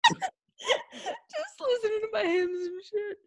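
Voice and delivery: repeating it through real laughter; slow, high, breaking up with laughs; laughing. Real, not acted. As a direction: laugh your way through the words. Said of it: "laughs while repeating".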